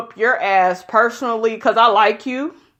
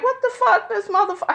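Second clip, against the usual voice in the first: higher, stunned "What the fuck?"; shocked reaction; 12 semitones higher, much faster than usual. Retold or imitated. Vlogger reacting to Nia's new body, stunned high exclamation. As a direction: act out your own disbelief at her new body. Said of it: "I'm like".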